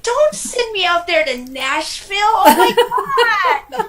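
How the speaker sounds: high, loud, laughing wail of protest; a California girl's mock despair at Nashville; mock outraged protest. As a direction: cry out in hammed-up disbelief at being sent away. Protesting being sent to Tennessee.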